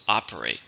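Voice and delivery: Operate, stressed on OP; first-syllable stress. Shown as a demonstration. Teacher models Operate for learners, stress on OP. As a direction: say Operate as OP-er-ate, voice dropped low. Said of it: "stress on the first".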